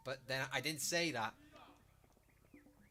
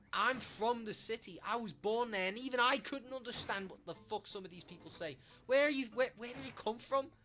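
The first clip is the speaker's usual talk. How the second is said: high, loud incredulous outburst; exasperated rant; 9 semitones higher, 5 dB louder than usual. Real, not acted. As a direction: let disbelief push the voice up high. Exasperated rant about not understanding locals.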